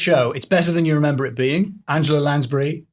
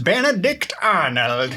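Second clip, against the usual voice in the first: slow drawn-out American accent, lightly put on; mock American accent; slower than usual. Hosts trading the name in a lightly put-on American accent. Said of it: "Hence the accents".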